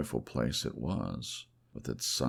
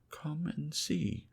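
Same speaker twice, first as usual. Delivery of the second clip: high, slow, longing call; eager cry; 14 semitones higher, slower than usual. Acted. Woman on the balcony calling her lover to see, a high eager cry. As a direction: draw him to the window with a lifted voice. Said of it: "she cried".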